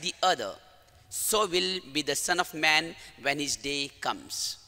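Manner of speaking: solemn, measured gospel proclamation; gospel reading. Acted. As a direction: read the gospel with reverence. Priest reads the gospel.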